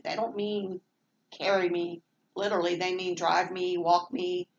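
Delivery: ordinary delivery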